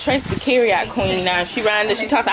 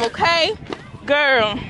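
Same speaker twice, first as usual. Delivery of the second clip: ordinary delivery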